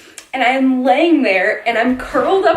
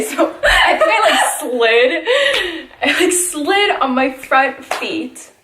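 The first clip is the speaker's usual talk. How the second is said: giggling through the words, pitch jumping up; can't stop laughing at her own fall; laughing while telling; 5 semitones higher, slower than usual. Real, not acted. Laughing while telling friends about slipping.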